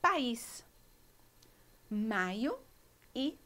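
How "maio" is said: Maio with an open unstressed ending; maio without accent. Shown as a demonstration. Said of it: "Viram como são palavras completamente diferentes".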